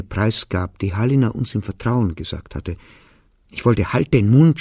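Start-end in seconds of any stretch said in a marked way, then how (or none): 3.9-4.6 raised, higher voice, a burst of anger; imagined shout. A narrator's reading. Child longing to silence mother for betraying secrets, shouting. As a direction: snap it out loud, as read in narration.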